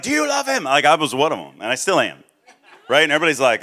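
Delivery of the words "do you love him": much higher and earnest; mock eager zeal; eager young evangelist. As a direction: press the question with wide-eyed zeal. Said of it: "they're like".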